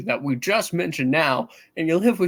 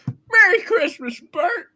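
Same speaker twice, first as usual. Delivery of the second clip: much higher, loud and slow; overjoyed; 13 semitones higher, 6 dB louder, slower than usual. Acted. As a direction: sing out the greeting in joy. Man overjoyed, greets the cop.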